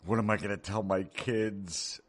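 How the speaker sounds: mock whiny parent. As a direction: whine it like a hand-wringing parent. Mocking whiny liberal parent.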